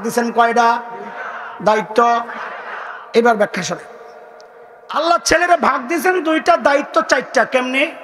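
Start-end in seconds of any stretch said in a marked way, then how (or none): none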